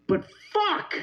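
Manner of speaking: frustrated outburst. Real, not acted.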